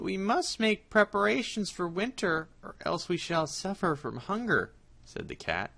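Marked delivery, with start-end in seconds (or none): 0.0-4.7 high, slow-starting cat voice, scheming; sly cat. Acted. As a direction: play the cunning cat planning ahead.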